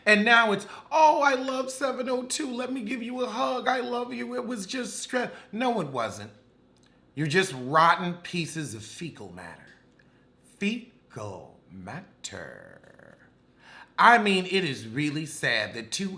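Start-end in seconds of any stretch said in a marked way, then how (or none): 0.9-5.3 mock fake sweetness. Mocking the twins' fake affection. 10.6-12.7 slow, low, quiet deadpan echo of "Fecal matter"; deadpan repeat. Real, not acted. Insult then slow echo.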